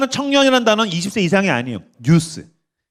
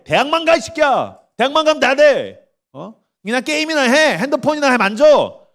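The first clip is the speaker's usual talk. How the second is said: higher-pitched, hammed-up scolding; scolding adult; 5 semitones higher than usual. Preacher acts adults suppressing teenagers, scolding. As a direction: order the teenager to stop asking.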